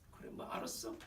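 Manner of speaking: low-volume dismissive shrug in the voice; cynical, grudging. Acted. Preacher acting a reluctant Gentile believer, cynical. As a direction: concede it softly and grudgingly. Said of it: "좀 냉소적이고 못마땅한 목소리처럼".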